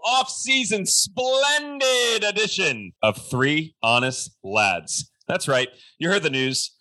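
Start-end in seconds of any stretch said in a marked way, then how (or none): 0.0-5.1 stretched, shouted podcast opener; big booming welcome to the show; hype show intro. Acted. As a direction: hype up the listeners like a show announcer.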